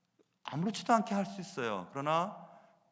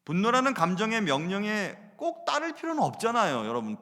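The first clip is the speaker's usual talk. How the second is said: ordinary delivery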